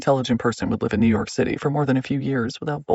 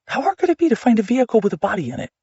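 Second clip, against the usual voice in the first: frantic; 8 semitones higher, faster than usual. Acted. Frantic inner thought.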